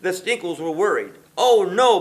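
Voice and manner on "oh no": loud alarmed cry of oh no; shout. A narrator's reading. Boris cries out as his town floods, shouting. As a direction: yell it out in sudden dismay. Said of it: "Boris shout".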